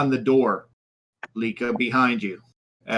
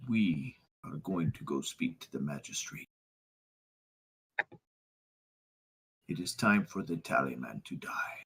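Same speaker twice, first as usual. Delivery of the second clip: low, much softer cold vow; grim quiet resolve; 5 semitones lower, 12 dB quieter than usual. Acted. Grieving father telling his son Anton, very soft and low. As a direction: speak softly, deadly calm.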